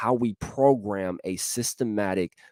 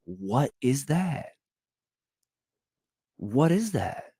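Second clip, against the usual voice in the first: puzzled reaction; 4 semitones higher, 3 dB quieter, slower than usual. Acted. Acts out reaction to body resisting.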